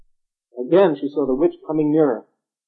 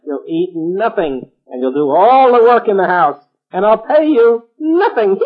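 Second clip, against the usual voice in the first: witch voice; 6 semitones higher, 8 dB louder than usual. A narrator's reading. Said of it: "who was a witch".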